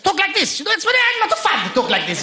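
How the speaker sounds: high-pitched Middle Eastern accent; comic high-voiced Middle Eastern accent; put-on accent. Shown as a demonstration. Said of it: "start with an accent".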